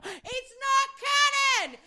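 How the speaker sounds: high, drawn-out mock yell; slow, high-pitched retold outcry. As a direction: wail it like a wounded fan. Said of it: "I yelled a lot louder".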